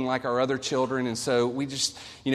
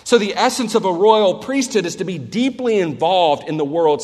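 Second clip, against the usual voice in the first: preaching at full force; 4 semitones higher, 6 dB louder than usual. Acted.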